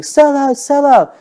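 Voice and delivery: high, loud jeer; crowd yelling. Retold or imitated. Crowd heckling the singer on stage, yelling. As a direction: imitate hecklers shouting from the audience. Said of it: "when they started saying".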